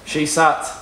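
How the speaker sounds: usual speaking voice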